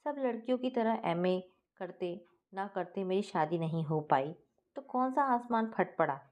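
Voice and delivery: ordinary delivery, nothing marked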